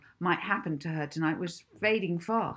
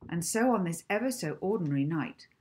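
plain reading voice

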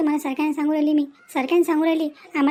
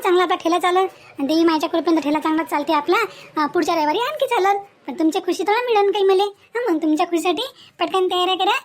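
ordinary talk, nothing marked

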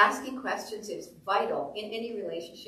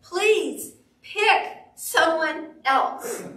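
high, loud, very slow plea; drawn-out, emphatic pleading; Moses pleading; 7 semitones higher, 7 dB louder, much slower than usual. Acted. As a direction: beg word by word to be let off. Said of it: "Moses says".

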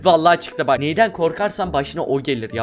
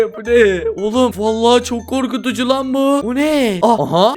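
ordinary talk, nothing marked

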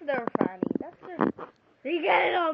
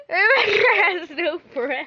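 loud teasing; 3 semitones higher, 12 dB louder than usual. Pretend. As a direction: laugh and yell the taunt. Teasing friend with laughter.

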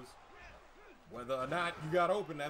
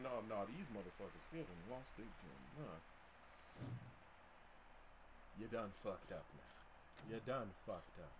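low menacing threat; 9 semitones lower, 15 dB quieter than usual. Real, not acted. Low vengeful threat after interception.

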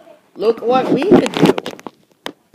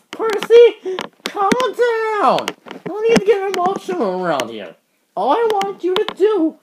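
much higher, hurried voice; high urgent calming; 9 semitones higher than usual. Acted. Engine urging an upset Percy to calm down. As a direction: settle them down urgently.

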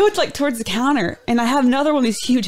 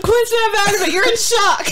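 laughing; 10 semitones higher than usual. Retold or imitated. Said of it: "She's like".

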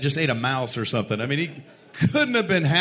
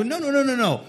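high, rapid-fire string of denials; hasty reassurance; 9 semitones higher, faster than usual. Retold or imitated. Soothing a receptionist afraid of being fired, high-pitched reassurance. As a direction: rush to head off the worry, high.

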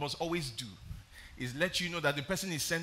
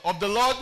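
"Lord" louder still, rising; stress on Lord; 5 semitones higher, 11 dB louder than usual. Shown as a demonstration. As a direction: repeat "Lord" to the church. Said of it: "You didn't hear me, church".

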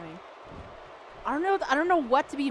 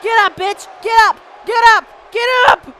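high, loud repeated orders, building; fierce yelling at an opponent; aggressive shouted command; 8 semitones higher, 12 dB louder than usual. Real, not acted. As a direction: bark it louder each time.

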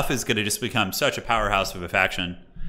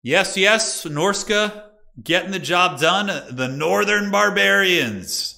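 excited cheer; 6 semitones higher, 4 dB louder, much slower than usual. Real, not acted. Cheering the winning faction.

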